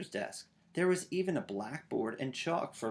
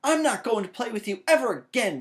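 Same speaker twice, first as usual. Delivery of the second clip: high, loud storming-off yell; angry shout; 11 semitones higher, 9 dB louder, faster than usual. A narrator's reading. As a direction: swear it off for good. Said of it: "shouted Sister".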